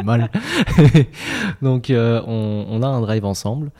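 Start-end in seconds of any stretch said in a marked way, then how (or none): 0.3-1.6 nervous laugh. Real, not acted. Joke about hacking their Drive.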